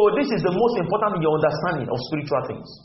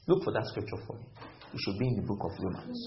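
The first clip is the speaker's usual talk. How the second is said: whispered instruction off-mic; low, quick aside to a helper; quiet aside; 9 semitones lower, 12 dB quieter than usual. Real, not acted. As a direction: faster and much softer. Aside to scripture reader.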